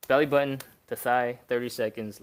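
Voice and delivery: belly button punched out loud and energetic; rally the viewer into the hold; loud coaching cue. Shown as a demonstration. Coach kicking off the first hold, loud and punchy. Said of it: "Let's go".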